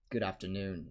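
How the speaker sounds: a touch low, understated smoothness; smooth. A narrator's reading. Snape surprising the three indoors, smooth. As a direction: greet them smoothly. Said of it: "he said smoothly".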